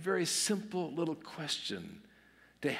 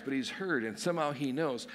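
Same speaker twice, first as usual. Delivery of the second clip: ordinary delivery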